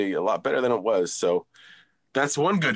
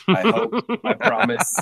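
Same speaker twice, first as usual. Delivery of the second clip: jumps high, bright and louder; high playful promise; 11 semitones higher, 4 dB louder than usual. Real, not acted. Guest joking as he drops off the call, high and playful. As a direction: sing out the promise, half joking.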